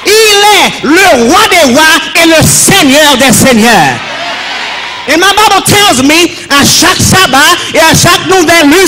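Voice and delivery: high, ringing declamation with long-held phrase ends; full-force preaching. Real, not acted. Preacher extols his Father to the congregation, full-force preaching. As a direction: proclaim each phrase to the whole congregation.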